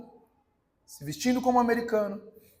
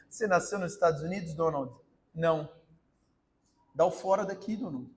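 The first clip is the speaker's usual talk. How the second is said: dry immigration officer; 6 semitones lower, 8 dB quieter than usual. Acted. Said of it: "gente de imigração é normalmente bem seca".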